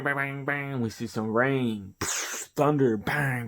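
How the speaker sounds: ordinary talk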